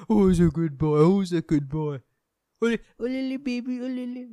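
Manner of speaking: put-on high, cooing baby talk to a dog. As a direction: coo at the puppy like a doting owner. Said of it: "kid and dog voices".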